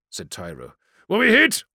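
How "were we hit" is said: loud, high, urgent; tense raised battle voice; alarmed question. Acted. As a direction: ask it sharply in alarm. Commander asks about damage.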